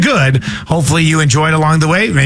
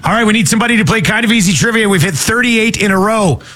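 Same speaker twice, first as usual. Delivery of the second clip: normal talking voice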